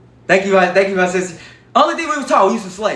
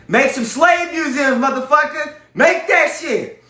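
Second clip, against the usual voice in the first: high, slow mocking shout; hammed-up boss yelling; bossy mock order; 10 semitones higher, slower than usual. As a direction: play the boss barking orders. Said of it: "Here you go".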